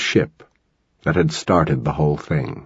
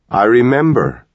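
suddenly loud; 6 semitones higher, 4 dB louder than usual. A narrator's reading. Mother among the gurneys, suddenly loud. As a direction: burst out high and loud. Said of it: "in a suddenly loud voice".

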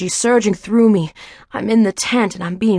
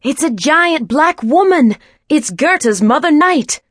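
higher, louder alarmed exclamation; startled cry; 6 semitones higher, 4 dB louder, slower than usual. Acted. Someone in the crowd crying out in alarm, louder. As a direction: call out in alarm at the sight.

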